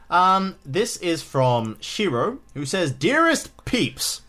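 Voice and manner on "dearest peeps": mock formal greeting. Reading letter greeting.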